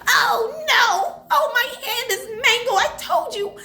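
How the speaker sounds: very high, hammed-up wail of mock horror; overdramatic. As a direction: play make-believe agony to the hilt. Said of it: "'cause I'm so dramatic".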